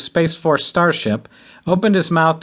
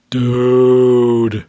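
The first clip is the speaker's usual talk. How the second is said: lowing dude; 2 semitones lower, 4 dB louder than usual. A narrator's reading. Big best friend lowing a greeting at the groom. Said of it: "Dennis lowed".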